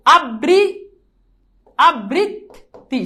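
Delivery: আবৃত্তি drawn out very slowly, syllable by syllable; slow demo. Shown as a demonstration. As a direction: stretch আবৃত্তি out slowly for students. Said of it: "আমি একটু try করি". (ধীরে ধীরে আবৃত্তি উচ্চারণ করে দেখানো)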